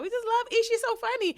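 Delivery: high mock gushing; gushing fans. Imitating fans praising her podcast, high gush. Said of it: "everybody was like".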